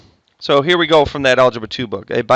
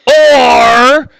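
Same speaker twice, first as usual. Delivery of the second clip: drawn-out, higher and louder, deliberately obnoxious; 7 semitones higher, 5 dB louder, slower than usual. Shown as a demonstration. Teacher stressing alternatives to the class, obnoxiously. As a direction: lean on it to annoy the class. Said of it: "why am I saying or obnoxiously".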